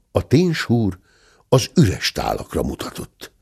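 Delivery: ordinary delivery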